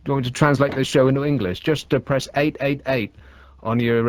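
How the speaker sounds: ordinary talk, nothing marked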